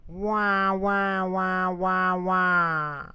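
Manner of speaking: put-on high wailing baby cry. A narrator's reading. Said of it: "The baby cried even louder".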